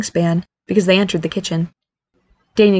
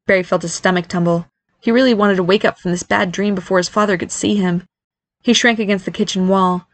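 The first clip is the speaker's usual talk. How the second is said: ordinary delivery, nothing marked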